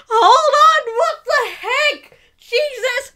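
high, tearful outburst of shock; really emotional. Real, not acted. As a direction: cry out, overwhelmed and half laughing. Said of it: "I'm getting really emotional".

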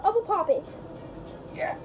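usual speaking voice

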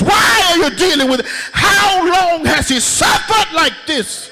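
whooping preacher crying out to the church; preaching at full force. Acted. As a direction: build the sermon to a roaring peak. Preaching climax, shouting.

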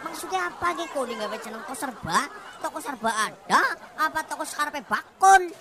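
ordinary talk, nothing marked

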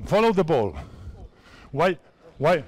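normal talking voice

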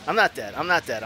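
ordinary talk

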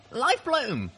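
high, urgent call for help; urgent shout. A narrator's reading. Narrator calls the healer for a dying friend, urgent shout. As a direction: call out the name desperately. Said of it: "I shouted".

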